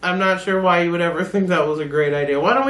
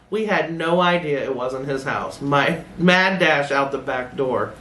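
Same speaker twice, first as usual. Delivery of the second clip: ordinary delivery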